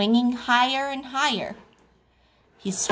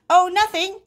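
high, louder denial; dismissive little frog; 10 semitones higher, 6 dB louder, faster than usual. A narrator's reading. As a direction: brush mother off in a high voice. Froggy hides his mishap from mother.

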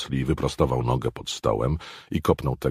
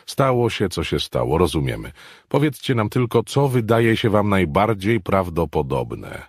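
a lightly voiced, louder, coaxing boss; 7 semitones higher, 6 dB louder than usual. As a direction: reassure them into answering. Hicks coaxes the men.

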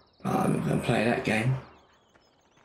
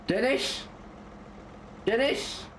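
high, questioning call across the field; 9 semitones higher, 4 dB quieter, slower than usual. Real, not acted. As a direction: call a friend from far off. Calling out to a distant friend.

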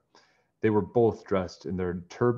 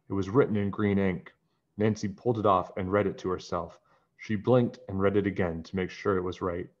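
usual speaking voice

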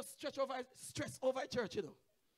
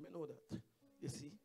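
ordinary talk

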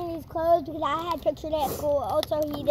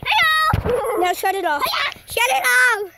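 loud calling; 4 semitones higher, 7 dB louder than usual. Pretend. Calling dog, ordering stop.

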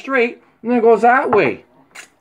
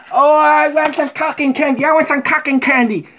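higher, whiny child's voice, lightly played; little kid begging; 7 semitones higher than usual. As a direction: mimic a small kid pleading at the fair. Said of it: "the youngest one would fucking say".

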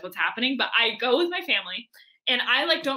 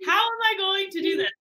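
mock desperation; 8 semitones higher than usual. Founder running out of money, high helpless mock plea. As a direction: plead it high, comically helpless. Same retold panic, said once more.

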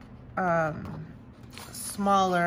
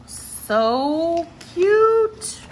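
much higher, louder delighted squeal; 10 semitones higher, 10 dB louder than usual. Real, not acted. Squeals at cute stamp.

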